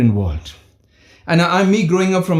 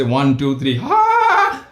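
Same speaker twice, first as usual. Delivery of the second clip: teen forcing a laugh at a bad joke; forced laugh; 4 semitones lower, 4 dB louder, much slower than usual. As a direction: burst a fake laugh on cue. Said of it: "and they begin to say".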